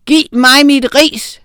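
enraged teacher's roar. A narrator's reading. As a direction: bellow each word of the order. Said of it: "brølede herr Dummesen".

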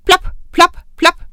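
high, loud plop sounds; popping noise. A narrator's reading. Narrator voicing warts popping out, high popping noise. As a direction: pop out each plop like a bubble.

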